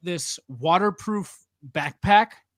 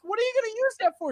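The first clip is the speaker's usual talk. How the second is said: laughing question; faster than usual. Retold or imitated. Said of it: "I was like".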